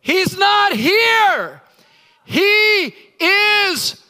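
high, loud, slow booming proclamation; stretched angelic shout; angel's shout. Acted. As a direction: announce it like a triumphant angel. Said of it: "And the angel said".

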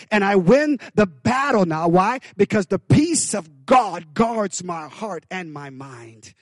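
normal talking voice

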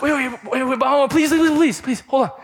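high, rushed begging please; pleading. Retold or imitated. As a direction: beg mom to let him explain. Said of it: "and so I was like".

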